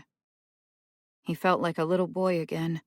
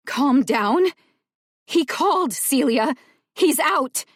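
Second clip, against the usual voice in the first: loud, much higher, frantic; panicked shout; 9 semitones higher, 8 dB louder than usual. Acted. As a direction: shriek it out in disbelief.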